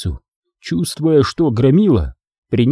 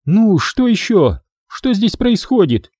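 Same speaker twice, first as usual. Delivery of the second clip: irritated; 8 semitones higher than usual. Acted. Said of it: "раздражённо спросила".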